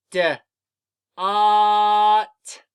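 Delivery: short "D", pause, then long loud "ot"; sounding out. Shown as a demonstration. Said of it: "Say the sounds with me".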